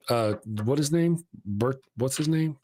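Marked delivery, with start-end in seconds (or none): none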